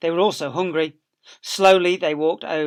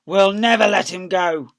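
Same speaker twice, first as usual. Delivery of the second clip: loud, stubborn; declaring; 5 semitones higher, 9 dB louder than usual. Acted. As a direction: vow it to the others. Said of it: "Bunce declared".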